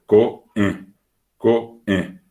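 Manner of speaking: coe as ko, glottal break, then e; separated vowels. Shown as a demonstration. Teacher demonstrating the puso, coe split by a stop. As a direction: cut the voice inside coe, two slow pieces. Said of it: "Y esa separación".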